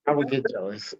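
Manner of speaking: quiet, trailing mumble; half swallow the words; mumbled. Real, not acted. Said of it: "Wait, say that again?".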